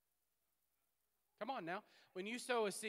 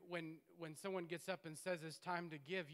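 ordinary talk, nothing marked

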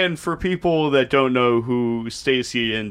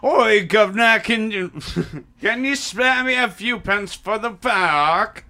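hammed-up British accent; 8 semitones higher than usual. Friend begging a governor for pence, hammed-up British accent. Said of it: "That was an accent".

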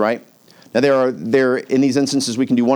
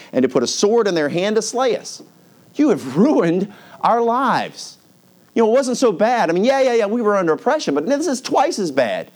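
high-pitched, aggrieved griping with mock thanks; sarcastic complaint; 6 semitones higher than usual. Israelites blaming Moses and Aaron, sarcastic complaint. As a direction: complain in a higher, exasperated voice.

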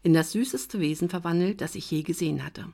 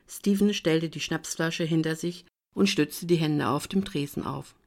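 usual speaking voice

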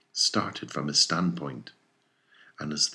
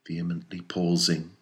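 ordinary delivery

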